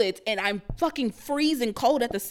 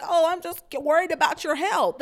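mock concern; 6 semitones higher than usual. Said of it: "act like".

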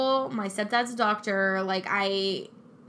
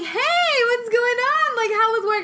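cheerful greeting; 5 dB louder than usual. Retold or imitated. Husband answering phone casually.

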